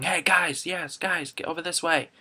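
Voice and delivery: high-pitched calling out to a crowd; mild mock scream. Worker herding large groups, acting out a scream. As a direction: call out high, half-strength yell. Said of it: "I'm screaming".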